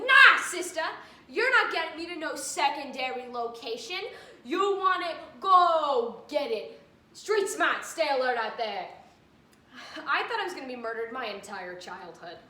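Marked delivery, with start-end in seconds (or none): 0.1-8.9 mock tough refusal. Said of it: "I'll be like".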